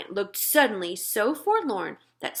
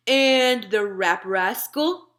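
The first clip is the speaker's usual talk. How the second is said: drawn-out slow giant voice, a touch louder; slow giant teasing; 4 dB louder, slower than usual. Acted. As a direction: savour the made-up name slowly. BFG listing, slowed.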